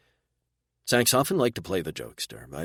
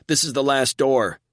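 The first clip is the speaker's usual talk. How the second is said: yelling; 8 semitones higher, 7 dB louder than usual. A narrator's reading. Still yelling while running.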